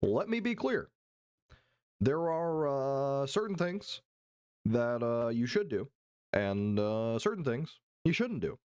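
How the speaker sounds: measured, pausing Obama cadence; Obama impression. Streamer imitating Obama lecturing chat with long pauses. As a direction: lay out each clause with long statesman pauses.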